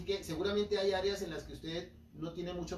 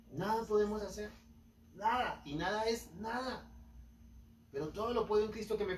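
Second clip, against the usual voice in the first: emphatic preaching; 7 semitones higher than usual. Acted. A preacher hammering home "nada" to his congregation.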